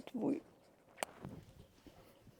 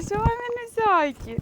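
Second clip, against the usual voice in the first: cooing; 9 semitones higher, 3 dB louder than usual. Real, not acted. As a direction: coo to the puppies, slowly and high. Cooing to puppies.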